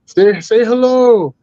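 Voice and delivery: high, slow called-out voice; lofty slow voice calling into the trees; calling out as Jesus. Pretend. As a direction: hail the woods from afar like a holy visitor. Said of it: "I yell out to them".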